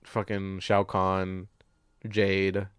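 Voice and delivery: ordinary talk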